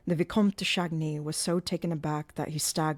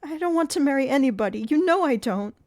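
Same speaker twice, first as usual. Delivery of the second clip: much higher, louder, fast; frightened protest; 9 semitones higher, 8 dB louder than usual. A narrator's reading. Christine protesting to Eric, high fast panic.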